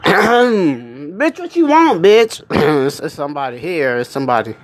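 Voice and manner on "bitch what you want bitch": sassy Alexa. Retold or imitated.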